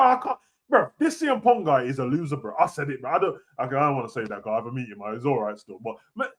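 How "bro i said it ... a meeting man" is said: normal talking voice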